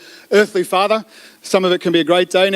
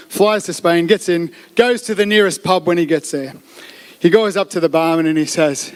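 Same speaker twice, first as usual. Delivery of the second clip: ordinary talk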